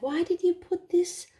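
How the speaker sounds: retelling the husband's annoyed question, pitched high. Retold or imitated. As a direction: imitate his fussy complaint. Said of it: "He was annoyed".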